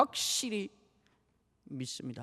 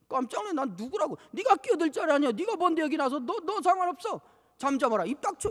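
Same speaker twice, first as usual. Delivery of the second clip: high, sharp scolding crowd; mock rebuke; 6 semitones higher than usual. Preacher voices the crowd telling the beggar to shut up. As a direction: hush him like an annoyed bystander.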